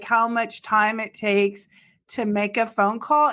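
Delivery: ordinary talk, nothing marked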